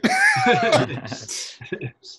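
laughing burst, way up high; sudden high giggle; high-pitched laugh. Real, not acted. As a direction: break into a squeaky laugh. Laughs at translating moment.

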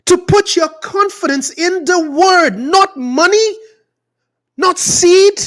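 high, insistent protest; exasperated preaching. Real, not acted. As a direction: plead the point with rising indignation. Exasperated plea against seed preachers.

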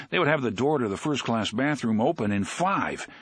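ordinary delivery, nothing marked

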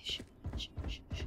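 whispered calming shh; shushing whisper. Real, not acted. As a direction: hush yourself softly. Calming himself down.

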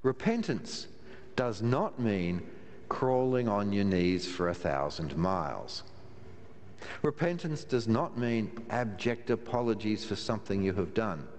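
ordinary talk, nothing marked

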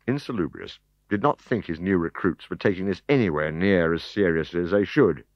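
plain reading voice

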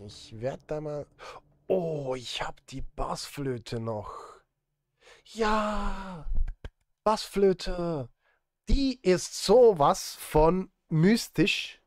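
usual speaking voice

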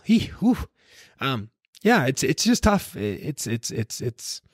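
real emotion breaking through, then pushing on; choked up. Real, not acted. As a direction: voice cracking high, trying to hold back tears. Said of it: "I'm getting emotional".

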